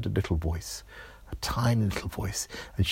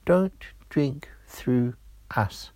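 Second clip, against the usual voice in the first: slow, whispery little straw voice; hushed warning, word by word; tiny voices in chorus; 5 semitones higher, much slower than usual. Acted. Two straws warning Georgia together, slow whispery chorus. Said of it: "the voices spoke together".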